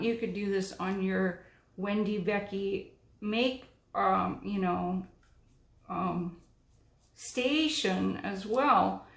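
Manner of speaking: ordinary delivery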